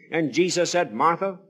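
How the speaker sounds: ordinary talk, nothing marked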